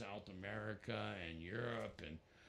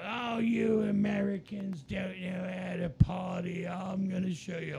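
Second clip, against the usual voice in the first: loud, higher, boisterous put-on voice; 8 semitones higher, 10 dB louder than usual. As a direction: bellow it like a rowdy rocker. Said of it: "with him in Hamburg".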